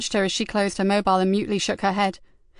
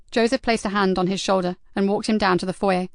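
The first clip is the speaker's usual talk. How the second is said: ordinary delivery, nothing marked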